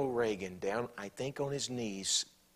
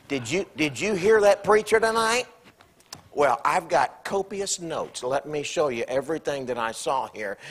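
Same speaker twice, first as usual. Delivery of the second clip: mock self-righteous spouse; 4 semitones higher, 9 dB louder than usual. Acted preachy spouse, louder.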